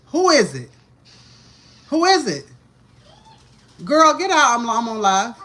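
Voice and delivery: high, loud yell at the door; yelling at intruder. Real, not acted. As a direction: shout someone out of the room. Yells at someone entering room.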